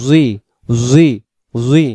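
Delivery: জুই with rounded lips, z sound. Shown as a demonstration. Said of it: "মুখটা গোল হবে".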